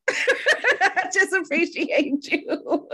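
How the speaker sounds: laughing through tears, high; choked-up laugh, slowed; teary laugh. Real, not acted. Overcome thanking guest.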